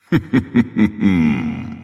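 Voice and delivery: short haughty boss laugh; mocking laugh. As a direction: laugh down at the challenger.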